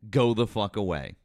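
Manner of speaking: ordinary talk, nothing marked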